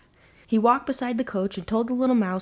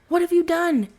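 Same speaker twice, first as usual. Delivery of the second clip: much higher and louder, a desperate cry; anguished cry; 10 semitones higher, 7 dB louder than usual. Acted. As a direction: cry out in horror as she falls. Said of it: "Vaco cried".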